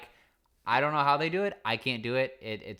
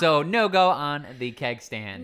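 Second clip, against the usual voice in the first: louder, laughing as she says it; 5 semitones higher, 9 dB louder, slower than usual. Real, not acted. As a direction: chuckle through the line. Laughing wrap-up of keg story.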